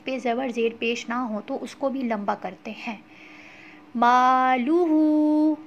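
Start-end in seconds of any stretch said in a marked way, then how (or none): 4.0-5.6 मालुहु with the final हु drawn out long; lengthened hu. Shown as a demonstration. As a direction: stretch the हु in मालुहु. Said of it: "हु को क्यों लंबा किया है".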